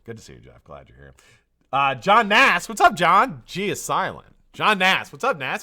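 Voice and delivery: ordinary talk, nothing marked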